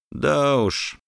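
пьяно, с перегаром; хрипловато выдохнуть, как пьяный; пьяный голос. Acted. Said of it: "дохнул на меня адским перегаром". (пьяный голос)

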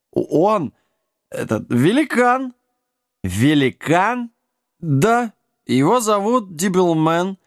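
high-pitched gnome voice; anxious gnome. A narrator's reading. Gnome begs Jack for rescue, anxious and high. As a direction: plead in a high, halting voice. Frightened gnome explains the giant.

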